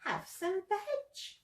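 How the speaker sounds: high, slow, soft hoot; hooted offer. A narrator's reading. Duckbills treating Drip to food, soft high hoot. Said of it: "And they hooted".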